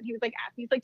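ordinary delivery

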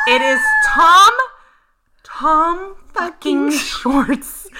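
shrieking then drawn-out slow name; fangirl squeal. Real, not acted. Fangirl reveal of celebrity.

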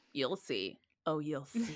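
low, hushed, ominous retort; judgmental, knowing warning. Retold or imitated. Imagined student silently judging the gloved teacher, low ominous warning. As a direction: say it low and soft, like a knowing threat. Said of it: "judgment eyes, and it was like".

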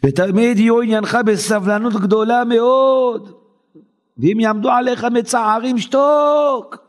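high, drawn-out ringing declamation; preaching recitation. Real, not acted. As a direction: stretch the last word long. Reading holy text in chant.

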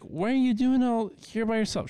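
mock high-pitched questioning; imitating concerned woman. Retelling old woman confronting someone.

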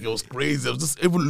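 ordinary talk

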